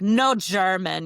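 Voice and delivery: slow, loud mock refusal; displeased French lady. Traveler imitates French flight lady refusing German, slow and displeased. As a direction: imitate a disapproving refusal, stretched out. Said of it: "she's not happy about that".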